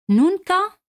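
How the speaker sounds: high, loud "Nunca"; emphatic. Shown as a demonstration. Teacher brightly introduces the next vocabulary word. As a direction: announce the new word with a bright high call. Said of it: "teacher announces new word".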